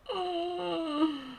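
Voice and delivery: high teary laugh-sob breaking out; crying laugh. Real, not acted. Reader laughing and crying over a book. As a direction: laugh through real tears. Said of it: "I'm gonna cry".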